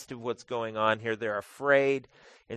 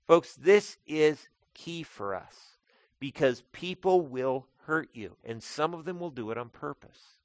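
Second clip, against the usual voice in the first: fervent preaching; 3 semitones higher, 6 dB louder than usual. Acted. Pastor warning congregation about hurt, impassioned preaching. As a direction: drive the point home to the folks.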